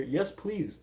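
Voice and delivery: normal talking voice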